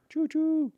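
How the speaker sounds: high, soft choo-choo; train whistle imitation. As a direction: toot softly like a little train.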